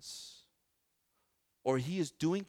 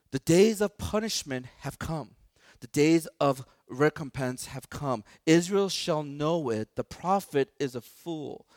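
slow, loud, weighty reading of the prophet; solemn scripture reading; 5 dB louder, slower than usual. Acted. Pastor reading Hosea aloud to church, slow and solemn. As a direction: proclaim the verses slowly to the congregation.

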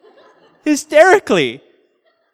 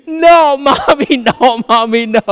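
higher, louder pleading cry; imitated child's screaming; 6 semitones higher, 6 dB louder, slower than usual. Boy on mom's back screaming at her to stop, loud high plea. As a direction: shout it like a scared kid on mom's back. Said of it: "And he was screaming".

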